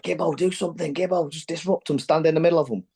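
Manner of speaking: high, pleading voice of Joey; urging teammate. Retold or imitated. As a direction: mimic Joey begging him to act. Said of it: "And Joey was like".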